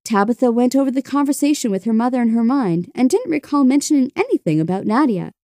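ordinary delivery, nothing marked

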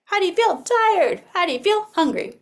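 high, bright questions to the class; teacher greeting the class each morning, high and lilting; sing-song morning check-in. Shown as a demonstration. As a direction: ask the little ones in a lilting teacher voice. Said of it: "I'm asking my friends".

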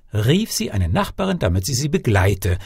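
plain reading voice

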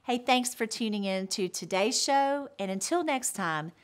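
low, measured announcement voice; show promo read. Acted. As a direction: deliver a polished sign-off, pitched well below stage talk. Recorded promo and sign-off to viewers.

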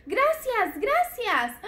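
high chirpy thanks; puppet doctor voice. Pretend. Toy doctor thanking the children, high puppet voice.

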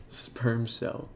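ordinary talk, nothing marked

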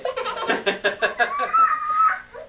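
shrieking high laugh; high laughter. Real, not acted. Big laugh.

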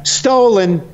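high-pitched imitated heckle; mock heckler yell. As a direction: call it out like a heckler, half-laughing. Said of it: "somebody in the audience yells out".

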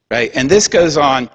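normal talking voice

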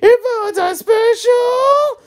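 sudden squeaky high crack, slow; a voice jumping way up mid-line. Acted. Reading the game text aloud, voice suddenly cracks high. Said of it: "I don't know why my voice changed there".

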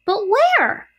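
put-on worried young pig. A narrator's reading. Wilbur asks the departing spiders, anxious. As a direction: pipe up as an anxious little pig.

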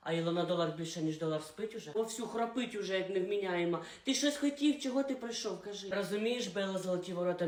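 soft, secretive late-night voice; hushed talk. Acted. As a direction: keep it down, others asleep.

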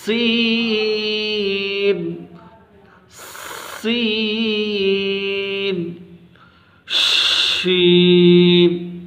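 سین and شین held very long with a hiss; hissing drawn-out سین and شین; whistling sibilant. Shown as a demonstration. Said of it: "یعنی کہ سیٹی کی آواز".

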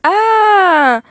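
shocked gasp. Acted. Shocked at no kitchen.

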